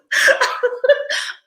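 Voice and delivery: loud, high-pitched laughter; high, breathless burst of laughs; real laughter. Real, not acted. Cracks up at the fancy cussing.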